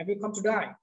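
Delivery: British "today" that sounds like "to die"; British pronunciation. Shown as a demonstration. Teacher repeating the foreigner's question, British pronunciation. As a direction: let "day" open into "die", British style. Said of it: "এটা যে আপনার British".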